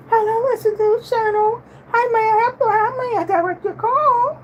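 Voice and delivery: very high, polite receptionist greeting; professional switchboard voice. Shown as a demonstration. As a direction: put on a high, proper phone voice. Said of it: "you know, professional".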